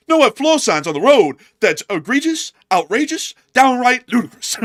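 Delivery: loud, high, indignant courtroom rant; attorney from Seinfeld. Acted. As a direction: belt out each outraged word, pitched high. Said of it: "that attorney from Seinfeld. He's gonna be saying".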